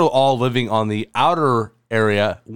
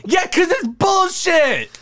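higher, slow, shouted indignation; upset shout. Real, not acted. Answering cohost who enjoys upsetting him, indignant shout. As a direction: blurt it out fed up. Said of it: "I love how upset this makes you".

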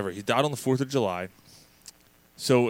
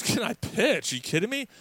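higher-pitched, cocky disbelief; mock incredulity; 10 semitones higher than usual. Pitcher answering his manager, playfully incredulous. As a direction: act insulted by the very question.

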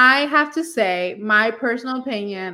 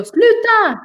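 Sluta barked higher and louder; angry parent; 11 semitones higher, 4 dB louder than usual. Retold or imitated. Parent yelling Sluta instead of stop, angry. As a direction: shout Sluta at the kids to make them stop. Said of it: "you go".

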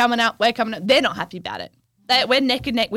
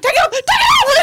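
high laughing shout, much higher and louder; panicked shriek; 6 dB louder than usual. Retold or imitated. Mimicking Maddie panicking in the water, high laughing shriek.

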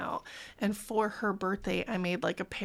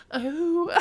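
mock moan; 8 semitones higher, 4 dB louder than usual. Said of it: "the next verse was like".

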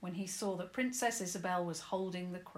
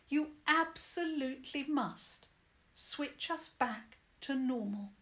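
firm; 6 semitones higher, 3 dB louder than usual. Acted. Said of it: "spoke firmly".

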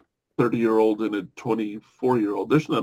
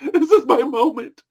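says it again through a laugh, higher; 11 semitones higher than usual. Shown as a demonstration. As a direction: laugh through the words, savouring them.

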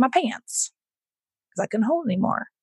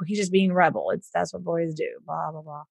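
dismissive shrug; 3 semitones lower, 7 dB quieter than usual. Retold or imitated. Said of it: "men or boys are like".

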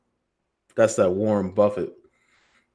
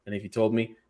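usual speaking voice

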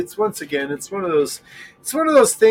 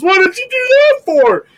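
high, louder outraged question; indignant protest; 14 semitones higher, 7 dB louder than usual. Retold or imitated. Driver asking the cop why, high loud outrage. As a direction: demand it, much higher and louder.

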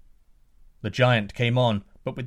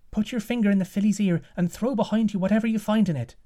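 much higher, fast urgent call; urgent daughter calling; 10 semitones higher, 3 dB louder, faster than usual. Acted. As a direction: call out to him, high and hurried. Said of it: "she called to the prince".

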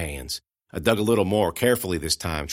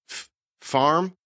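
higher halting voice; stammering girl; 8 semitones higher than usual. Acted. The girl questioning Amanda, stammering. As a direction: stumble over the first sound.

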